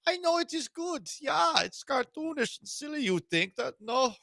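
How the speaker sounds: high cartoonish German, drifting Russian; silly villain accent gone Russian; German accent turning Russian. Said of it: "Turned Russian".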